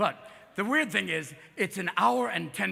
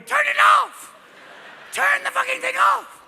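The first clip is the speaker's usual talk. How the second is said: high, loud screaming at the screen; frantic shout; panicked yell; 9 dB louder, slower than usual. Pretend. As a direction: shriek to make it stop. The hand panicking, yelling.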